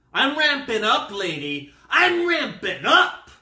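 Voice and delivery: high, loud mock freak-out, building; hammed-up escalating alarm; escalating panic. Acting out his rising panic.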